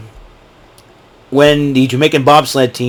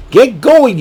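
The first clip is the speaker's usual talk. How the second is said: sheriff bark; 9 semitones higher than usual. Retold or imitated. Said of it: "quoting sheriff again".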